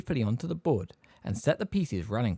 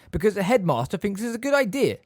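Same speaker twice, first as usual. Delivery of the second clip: high, smoothly superior answer; 10 semitones higher, 6 dB louder than usual. A narrator's reading. Snape answers Harry's protest, smoothly. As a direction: answer with polished condescension. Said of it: "said Snape smoothly".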